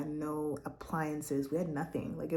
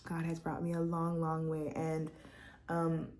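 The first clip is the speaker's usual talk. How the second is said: ordinary talk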